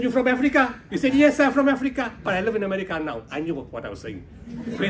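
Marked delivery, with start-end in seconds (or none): 0.0-0.7 eager call. Retold or imitated. Eager question to the soldier. 1.1-3.2 soldier's proud answer. Retold or imitated.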